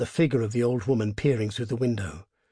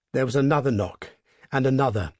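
plain reading voice

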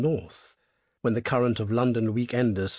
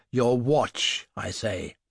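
menacing highwayman; much slower than usual. Acted. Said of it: "something very compelling in his voice".

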